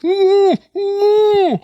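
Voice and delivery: very high, loud muffled cries; choked moaning. Pretend. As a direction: moan through a mouthful of dough. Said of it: "And the baker's like".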